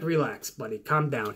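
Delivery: low, soft "relax, buddy"; calm hushed reassurance. Retold or imitated. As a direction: settle him down quietly, like an old boss. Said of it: "Vince was just like".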